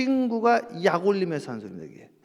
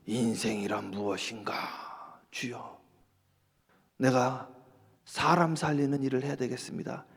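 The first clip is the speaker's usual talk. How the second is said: hushed vow; 11 semitones lower, 11 dB quieter than usual. Acted. Luther's vow after lightning.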